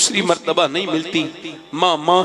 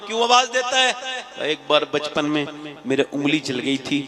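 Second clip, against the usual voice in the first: usual speaking voice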